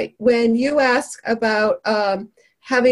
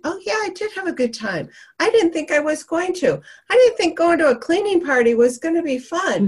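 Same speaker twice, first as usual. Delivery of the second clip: pleased guest; 6 semitones higher, faster than usual. Retold or imitated. Voicing guest's happy reaction.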